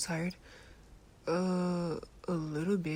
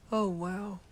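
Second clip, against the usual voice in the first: ordinary delivery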